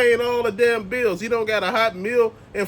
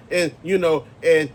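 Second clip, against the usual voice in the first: usual speaking voice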